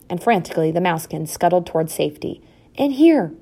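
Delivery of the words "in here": high call to shelter; urgent cry. A narrator's reading. Mother dives into the straw, crying out. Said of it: "cried Hithaca".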